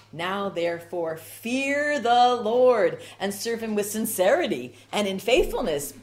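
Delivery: slow, loud proclamation of scripture; proclaimed verse. Acted. Preacher booming the verse to his congregation, slow and loud.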